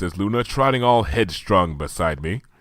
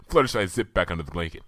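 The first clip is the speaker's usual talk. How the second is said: ordinary delivery, nothing marked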